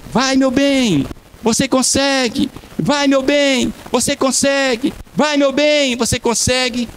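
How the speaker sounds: a wife urging her husband on; cheering wife. Retold or imitated. Wife cheering her husband during the race, high sing-song encouragement.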